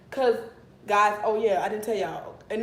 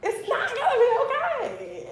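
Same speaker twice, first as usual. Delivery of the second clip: very high, slow mock whine with a laugh in it; mock wail; slower than usual. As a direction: wail it high like a hammed-up meltdown. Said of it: "And I'm like".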